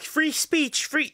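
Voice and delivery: high-pitched mocking quote; mock protesters. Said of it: "people be like".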